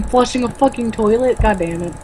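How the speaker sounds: ordinary talk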